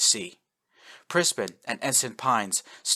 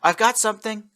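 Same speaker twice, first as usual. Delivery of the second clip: high, louder eager report; excited report; 12 semitones higher, 6 dB louder than usual. A narrator's reading. Ensign to captain, excited high report. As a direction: blurt out the find. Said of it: "Pines reported".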